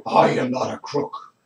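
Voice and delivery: low, whispery Nixon voice; hunched, jowly Nixon growl; Richard Nixon impression. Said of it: "You mean I".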